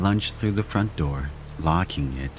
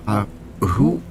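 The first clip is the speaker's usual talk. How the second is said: frightened; 5 semitones higher than usual. Acted. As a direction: stammer it out terrified. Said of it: "I could hear the fear in my voice".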